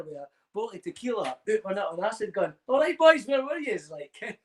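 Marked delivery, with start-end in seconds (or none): 2.7-3.9 cheery mock greeting. Retold greeting while on acid.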